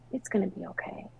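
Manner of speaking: soft, breath-light reassurance, nearly whispered; hushed consoling. Retold or imitated. Sister consoling her at the baby's bedside, hushed. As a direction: comfort in a hush, imitating a sister.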